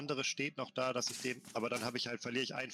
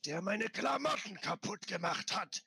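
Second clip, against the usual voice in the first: far too deep demon growl; menacing deep rumble; 7 semitones higher than usual. Acted. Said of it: "mit tiefer Stimme viel zu tief".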